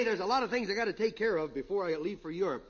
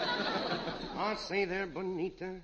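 suave mock British accent, slow to start; hammed-up Ronald Colman English gent. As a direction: charm the lady like a dapper Englishman. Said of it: "Ronald Colman loaned it to me".

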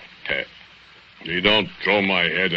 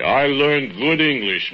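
proud boast; 9 semitones higher than usual. Acted. Bogdan boasts to Maya.

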